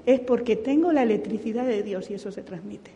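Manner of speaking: ordinary talk, nothing marked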